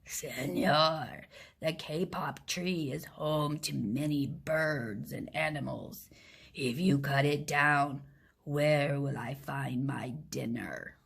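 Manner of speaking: low, growled jaguar voice, sinking to a whisper at the end; a hungry big cat growling into a sleeping man's ear. Acted. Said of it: "He growled in his ear".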